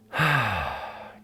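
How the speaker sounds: long open-mouthed sigh, falling from high; audible sigh. Shown as a demonstration. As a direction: sigh the breath out to release tension. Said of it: "open the mouth and sigh out the exhalation".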